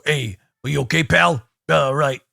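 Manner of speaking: put-on fast, casual New York accent. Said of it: "just do a New York accent".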